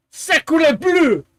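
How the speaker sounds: high, hammed-up French exclamation; fake French accent. Giving them his French words, exclaiming in mock French accent. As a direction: cry out like a pretend Frenchman. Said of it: "they want me to talk French".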